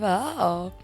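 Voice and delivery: normal talking voice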